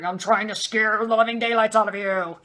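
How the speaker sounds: guttural, menacing imitation of a demon; imitated low demonic growl. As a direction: growl it out to terrify them. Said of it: "it would just get very low and guttural".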